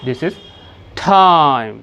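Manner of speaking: time with a t between ट and ठ, breathy; British English t in time; British aspirated t. Shown as a demonstration. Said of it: "ट और ठ के बीच का sound यह है".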